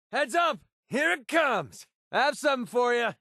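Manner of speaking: high, urgent call-out; shouted combat warning. Acted. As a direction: yell a warning across the battlefield. Combat warning called out to companion.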